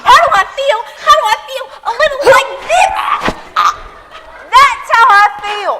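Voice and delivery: loud, indignant, high-pitched comeback; angry retort. Acted. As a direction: throw the question back at her, furious. Choked mother retorts angrily.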